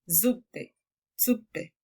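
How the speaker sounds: Zuppe said with a short clipped u; short syllable. Shown as a demonstration. Teacher showing a short syllable in Zuppe. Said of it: "será una sílaba corta".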